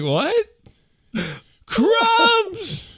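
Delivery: high incredulous question; baffled. Retold or imitated. Husband puzzled by wife's yelling, incredulous. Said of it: "I'm like".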